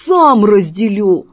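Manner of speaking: loud, slow menacing cat; forceful cat threat; threatening hiss. Acted. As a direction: growl a warning at a rival. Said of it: "угрожающе зашипел Васька".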